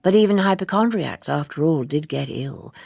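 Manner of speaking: plain reading voice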